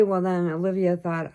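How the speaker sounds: normal talking voice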